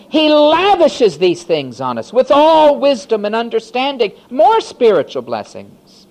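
high, loud jubilant preaching; loud, high exultant sermon; full-force preaching. Acted. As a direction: lift the whole room with it.

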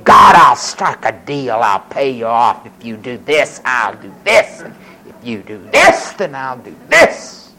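low-pitched, measured bargaining voice; deal-cutting. Acted. Preacher mimicking people making vows, deal-cutting. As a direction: lay out the terms to God. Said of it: "cutting deals with God".

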